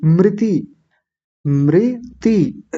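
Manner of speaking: मृति with a clear mri; correct मृति. Shown as a demonstration. Teacher drilling the second part, careful मृति. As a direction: split मृति slowly into mri and ti. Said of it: "ऐसा बोलना है".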